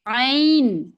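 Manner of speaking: عین with a strong throaty ain; ain from the throat. Shown as a demonstration. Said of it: "عین ادا ہوتا ہے". (حلق سے زور دے کر عین)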